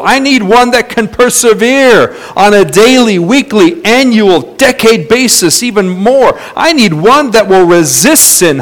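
rousing sermon delivery; fervent preaching. Acted. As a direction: preach it hard and high, building a list. Preacher exhorting in God's stead.

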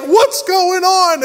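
high, slow mock panic; panicked sailors. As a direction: sailors crying out in alarm. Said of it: "they're like".

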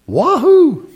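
high, loud whoop; excited whoop. Real, not acted. Preacher urging congregation on, excited whoop. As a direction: cheer it out.

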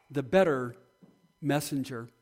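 ordinary delivery

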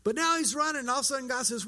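usual speaking voice

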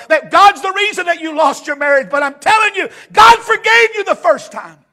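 loud, high, driving pulpit cry; full-force sermon climax; impassioned preaching. Real, not acted. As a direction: hammer it home to the congregation.

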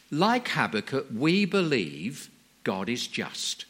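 ordinary talk

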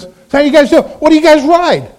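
high-pitched, chummy questions; gregarious dad. Retold or imitated. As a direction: chat up strangers eagerly, pitch lifted. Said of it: "Kayleen's dad is anything but shy".

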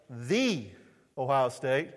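"The" said as a drawn-out THEE; emphatic definite article. Retold or imitated. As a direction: set "The" apart as a long THEE before Ohio State. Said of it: "that little definite article means".

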